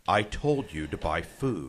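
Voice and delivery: low slow apocalyptic radio warning; Glenn Beck impression. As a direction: intone it like a doomsday prophet. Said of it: "dire warning, prophecy, apocalypse".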